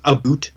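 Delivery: mock-Canadian "a boot", vowel like boot. Shown as a demonstration. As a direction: say "a boot" for about, with a long oo. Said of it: "like, other Canadian stereotypes".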